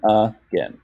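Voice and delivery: AGAIN split as uh, then gain; AGAIN in two beats, uh... gain; syllable by syllable. Shown as a demonstration. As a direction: separate the first A of AGAIN as its own beat. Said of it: "just a one-letter syllable".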